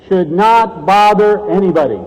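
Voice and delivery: usual speaking voice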